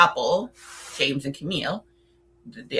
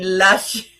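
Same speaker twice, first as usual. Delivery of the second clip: laughing; 5 dB louder than usual. Real, not acted. Mocking bougie French films.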